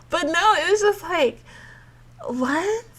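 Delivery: ordinary delivery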